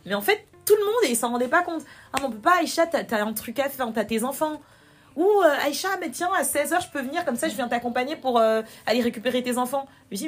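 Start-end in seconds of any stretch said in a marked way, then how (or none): 2.1-9.8 high, over-helpful mock voice; exaggerated concern, higher and lively; mimicked well-meaning friends. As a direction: imitate fussing friends, pitched up. Mimics friends deciding for her.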